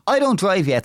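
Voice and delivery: usual speaking voice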